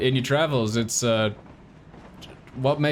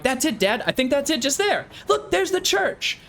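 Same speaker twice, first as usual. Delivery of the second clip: put-on much higher, eager kid's voice; excited young boy; 13 semitones higher than usual. Boy at the train window calling to his dad, high and eager. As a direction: point it out like an excited child. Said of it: "you can see him going".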